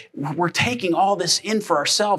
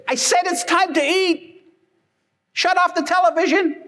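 much higher, impatient mom voice; mock mom insisting over the TV; annoyed mom; 12 semitones higher than usual. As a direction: repeat the call, losing patience. Mom repeats call, annoyed.

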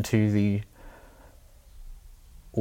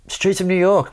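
higher-pitched imitation of a requester; lightly voiced drunk kid; 8 semitones higher than usual. Drunk English kid requesting a song, lightly imitated. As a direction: call it out like a young punter. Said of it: "Some kids like".

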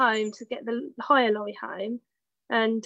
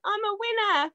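quoting Charlotte teasing her, higher pitched; a teasing, higher "I'm a winner"; mock triumphant; 10 semitones higher than usual. Friend mocking her tearful win, lifted teasing voice. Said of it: "You looked in the mirror and went".

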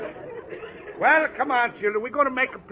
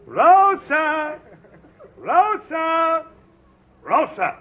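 calling out; 8 semitones higher, 5 dB louder, much slower than usual. Acted. Father calls daughter in.

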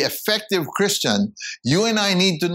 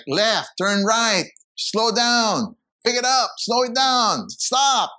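shouted cadences; 8 semitones higher, slower than usual. Acted. Guide calling cadences to a blind runner, mock shout. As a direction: guide calling directions, higher and slow. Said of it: "a guide that shouts at, shouts out cadences".

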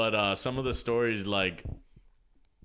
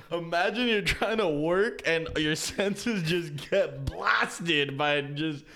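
overwhelmed worker; 7 semitones higher, 3 dB louder than usual. Retold or imitated. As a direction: play the smoke-blasted trash guy.

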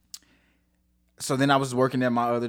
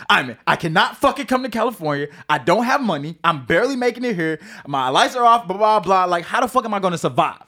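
exasperated protest; 6 semitones higher, 5 dB louder than usual. Retold or imitated. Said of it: "I was like".

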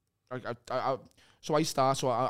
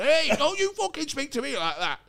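much higher, louder indignant retort; mock angry boss; 11 semitones higher, 5 dB louder than usual. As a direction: play the offended boss barking back. Boss snaps back, retold quarrel.

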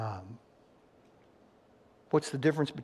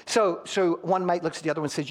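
ordinary talk

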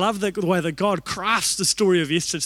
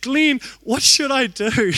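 higher, louder, slow desperate plea; hammed-up pleading to a friend; mock plea; 6 semitones higher, 5 dB louder, slower than usual. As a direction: beg a friend for help, half laughing.

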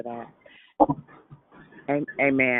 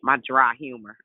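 ordinary talk

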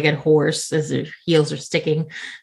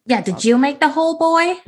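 higher, louder, fast pressing voice; pushy questioner; 11 semitones higher, 3 dB louder, faster than usual. Acted. Questioning the farmer's son about the hole, pushy. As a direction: lean on the boy hard.

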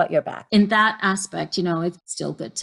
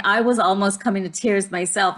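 ordinary delivery

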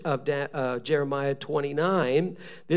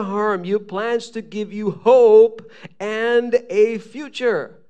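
raised, ringing Bible proclamation; emphatic scripture reading; 4 semitones higher, 5 dB louder than usual. Acted. Preacher reads the verse many know, proclaiming it. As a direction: declaim each promise to the congregation.

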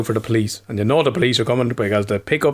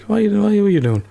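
higher-pitched, incredulous questioning; puzzled, pitched-up quote; retold baffled question; 8 semitones higher than usual. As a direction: mimic someone baffled by what they see. Retold partner's startled question.

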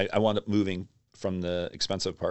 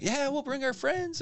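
retold eagerness. Young band agreeing to play a show, imitated eagerly. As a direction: imitate eager kids in a much higher voice.

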